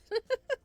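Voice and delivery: high loud burst of laughter; bright laugh. Acted. Xander laughs at Luca's innocent look, high and loud. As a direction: laugh like he's caught out. Narrator laughs mid-description.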